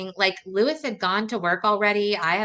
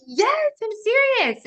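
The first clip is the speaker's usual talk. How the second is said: very high, slow, louder yes I'm serious; excited insisting; 14 semitones higher, 4 dB louder, slower than usual. Retold or imitated. Wife convincing husband of pregnancy, high slow joyful. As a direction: stretch it out, bursting with joy.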